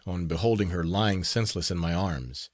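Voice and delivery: ordinary delivery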